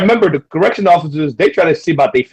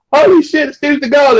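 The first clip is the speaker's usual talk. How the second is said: much higher, star-struck gasp; giddy fan recognizing a celebrity; excited exclaim; 12 semitones higher, 4 dB louder than usual. Retold or imitated. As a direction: blurt it out like a starstruck fan. People star-struck meeting Seagal.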